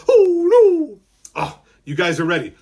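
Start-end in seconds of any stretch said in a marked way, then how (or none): 0.1-1.0 very high, loud mock-frightened wail; scared little brother. Showing kids how little brother gets scared, high frightened wail. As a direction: wail it like a terrified little kid. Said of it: "little brother gets scared like this".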